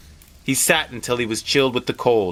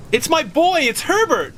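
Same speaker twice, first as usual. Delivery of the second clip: frantic cry. Acted. Mother fighting her husband to reach her son, frantic. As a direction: cry it out straining to reach the door. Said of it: "she cried".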